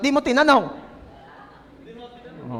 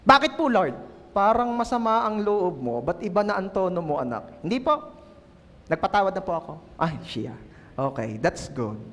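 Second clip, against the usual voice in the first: God's voice; 6 semitones lower, 5 dB quieter than usual. Retold or imitated. Pastor voicing God calmly speaking to a sister. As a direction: speak as a gentle deep Lord. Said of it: "Sasabihin ni Lord".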